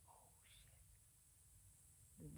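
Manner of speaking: barely voiced, hushed shock; whispered in disbelief; stunned whisper. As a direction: whisper it as the realization hits. Retelling her stunned realization.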